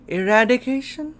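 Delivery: high, loud incredulous question; shocked disbelief. Real, not acted. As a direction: blurt it out in rising shock. Shocked reading of tournament name.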